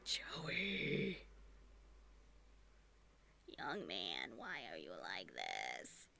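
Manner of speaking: very low, soft, slow reproach; exasperated mock scolding. Owner to cat after finding vomit, exasperated mock scolding. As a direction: let it sink into a low, weary telling-off.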